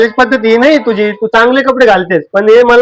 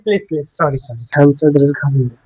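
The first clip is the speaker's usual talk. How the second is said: soft pleading; 8 semitones lower, 6 dB quieter than usual. Acted. Husband begging his upset wife to forgive him, soft pleading. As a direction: lower and much softer, begging sorry.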